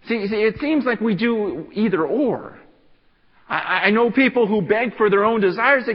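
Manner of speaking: loud, high, urgent preaching; full-force preaching. Acted. Pastor urging the church to pray, preaching loud and high. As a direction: preach it hard to the congregation.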